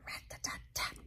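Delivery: very soft, hushed rat-a-tat; mysterious tapping. A narrator's reading. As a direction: whisper it like a faint tapping. Mysterious tapping noise in story.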